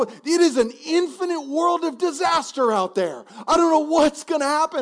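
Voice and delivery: put-on high, loud frantic kid voice; a scared little boy spiraling about what comes next; panicky child. Son panics to his parents, high and loud.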